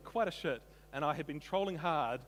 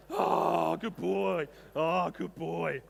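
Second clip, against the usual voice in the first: loud praise; 6 dB louder, much slower than usual. Real, not acted. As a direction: praise the dog loudly. Praising the dog.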